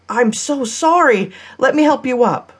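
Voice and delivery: high, flustered apology. A narrator's reading. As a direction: rush to make amends after knocking someone over. She apologizes after knocking him over.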